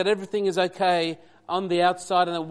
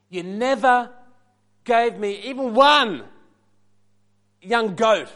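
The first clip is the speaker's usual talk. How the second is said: [name]'s sass; 4 semitones higher, 6 dB louder, slower than usual. Acted. Preacher voicing the bitter older son answering his father, sassy and slow. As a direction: lay on the grievance, drawing each phrase out then dropping away. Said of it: "I'm gonna try to give you the right sass".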